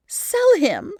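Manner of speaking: high, loud scornful echo; desperate scorn. A narrator's reading. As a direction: throw the words back in disbelief. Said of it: "with desperate scorn".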